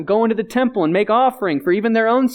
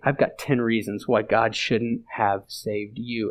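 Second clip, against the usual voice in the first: lower, softer, slow and smug; the enemy's voice; 8 semitones lower, 6 dB quieter, slower than usual. Retold or imitated. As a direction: drag it out like a gloating accuser. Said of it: "heard the enemy say".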